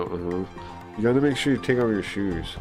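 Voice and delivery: ordinary talk, nothing marked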